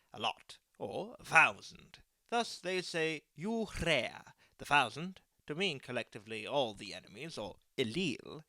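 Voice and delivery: plain reading voice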